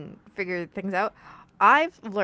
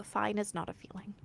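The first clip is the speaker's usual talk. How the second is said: whisper; 9 dB quieter than usual. Shown as a demonstration. Repeating her therapist's rule to the listener, whispered. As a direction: drop to a soft breathy whisper, no voice.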